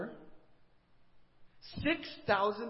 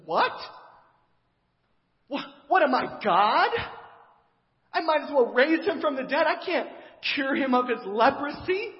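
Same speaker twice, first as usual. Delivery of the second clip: loud, higher cry of disbelief; distraught outburst; 7 semitones higher, 8 dB louder than usual. Retold or imitated. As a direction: shout it as a panicked king who can't believe the request. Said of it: "looks at it and says".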